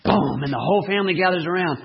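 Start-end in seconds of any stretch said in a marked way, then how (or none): none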